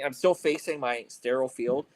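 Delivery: ordinary delivery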